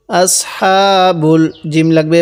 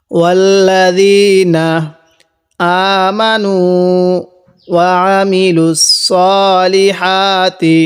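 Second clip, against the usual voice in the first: slow, melodic Quran recitation, pausing between phrases; word by word recitation; much slower than usual. Shown as a demonstration. Teacher recites the verse in pieces for students, slow tajweed chant. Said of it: "ভেঙ্গে ভেঙ্গে পড়ব".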